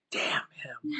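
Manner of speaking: mock whispered curse under the breath; muttered curse. Wife-like figure grumbling under her breath, mock whisper. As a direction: hiss it quietly, half-joking. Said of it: "She's like".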